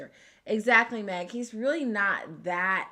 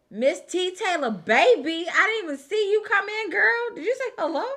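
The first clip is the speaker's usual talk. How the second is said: high, loud, gushing welcome; excited greeting; 12 semitones higher, 11 dB louder than usual. Real, not acted. Host greeting a viewer who just came in, excited. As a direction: squeal a delighted hello to a friend.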